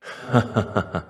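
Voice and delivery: a short chuckle at the memory; fond laugh. Acted. Shade recalling a happy day with an old friend, fond chuckle. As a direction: laugh once, remembering good days.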